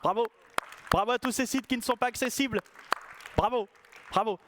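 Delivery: applaudissements ironiques, voix plus haute; félicite pour se moquer; sarcastic bravo. Pretend. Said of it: "Ça mérite un petit applaudissement". (bravo sarcastique, bien appuyé)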